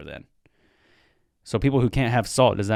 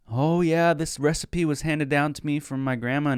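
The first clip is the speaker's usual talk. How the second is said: higher, smug proud voice; mock proud cook; 5 semitones higher than usual. Mocking a guest who claims grandma's recipe, smug and higher. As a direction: parody someone bragging about grandma's recipe.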